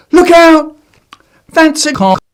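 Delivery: high loud warning cry; alarmed shout. Acted. Child warning friends, high alarmed shout. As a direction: yell a warning.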